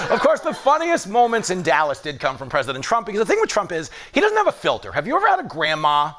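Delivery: ordinary talk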